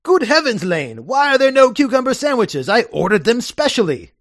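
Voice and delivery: hammed-up outraged toff; Algernon. Algernon scolds his butler over missing sandwiches, high and huffy.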